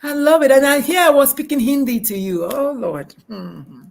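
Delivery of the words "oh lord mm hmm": ordinary talk, nothing marked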